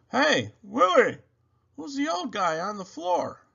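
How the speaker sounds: tease, puzzled and playful; Jose's voice. A narrator's reading. Jose voiced in story.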